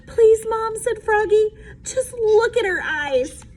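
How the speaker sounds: pleading. A narrator's reading. Froggy begging Mom to look at the puppy, pleading. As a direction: beg sweetly.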